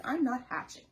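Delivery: loud flat refusal; a hint of a stubborn egg. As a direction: dig in and refuse. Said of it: "Egg does not wanna hatch".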